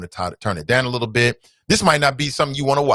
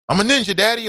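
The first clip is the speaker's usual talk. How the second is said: little son voice; 11 semitones higher than usual. Retold or imitated. Son boasting while dodging frisbees.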